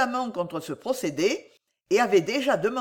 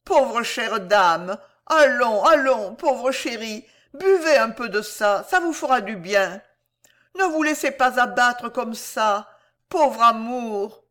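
higher, drawn-out cooing exclamations; effusive motherly sympathy, voice raised; compassionate hostess; 4 semitones higher, 5 dB louder than usual. A narrator's reading. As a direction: fuss over a fainting lady. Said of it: "la compatissante hôtesse ... diverses exclamations".

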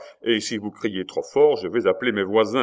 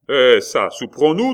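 loud, much higher, sharp and testy; sour demand; 5 semitones higher, 9 dB louder than usual. A narrator's reading. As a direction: snap the question to cut him off. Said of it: "demanda aigrement Coconas".

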